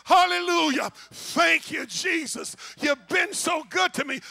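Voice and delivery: exultant gospel shout; shouted praise. Acted. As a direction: shout your thanks to heaven. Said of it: "when my time comes to say".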